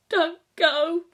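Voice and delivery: high, loud desperate plea; pleading. A narrator's reading. Boy pleading with his guardian not to leave, desperate high plea. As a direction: beg through rising tears. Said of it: "he pleaded".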